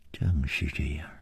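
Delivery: low, hushed, nearly whispered confirmation; subdued admission. Acted. Liu confirming the murder plot, subdued. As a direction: concede it very softly.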